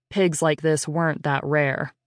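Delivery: ordinary delivery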